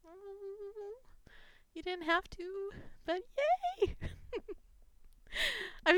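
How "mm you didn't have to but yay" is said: normal talking voice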